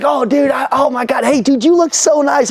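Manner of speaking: high-pitched, over-the-top enthusiasm; imitated gushing kid. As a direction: flatter him like an overjoyed young salesman. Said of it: "having the best day of his life".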